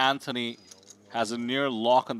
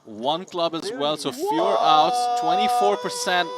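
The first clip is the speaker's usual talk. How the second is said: excited; 6 semitones higher, 5 dB louder than usual. Real, not acted. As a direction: call the odds, all fired up. Excited call of the odds.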